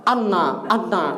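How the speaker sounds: "Anna" with a doubled, held n; wrong pronunciation. Shown as a demonstration. Teacher demonstrating the common mistake, doubled n.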